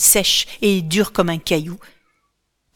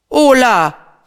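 loud, higher objection; protesting exclamation; 6 semitones higher, 8 dB louder than usual. A narrator's reading. Hermes protests to his brother, loudly. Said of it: "proteste-t-il".

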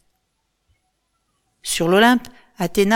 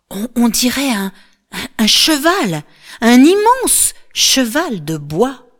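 halting, higher voice, slowing in awe; awestruck; 5 semitones higher, 5 dB louder than usual. A narrator's reading. Andromache sees the giant wooden horse, stammering in awe.

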